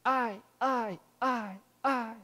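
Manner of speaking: fading echoed repeats. Acted. Mountains echo the boy's cry, fading echo. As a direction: let it ring back like a mountain echo. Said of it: "o eco começa".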